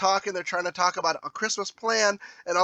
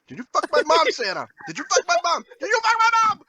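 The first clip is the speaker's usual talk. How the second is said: building mock outburst; 10 semitones higher, faster than usual. Retelling Charlie confronting the mall Santa, building up. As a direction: escalate each repeat. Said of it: "He's like".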